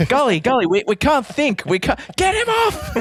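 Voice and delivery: high, laughing mock outrage; outraged fan voice.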